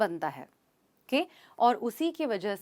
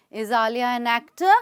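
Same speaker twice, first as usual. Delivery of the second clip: slow, louder, "actor" lifting at the end; rising question tone; 5 dB louder, much slower than usual. Shown as a demonstration. Teacher showing learners a question's tone, rising on "actor". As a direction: ask it slowly, tone climbing on "actor". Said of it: "the tone rises at the end of a".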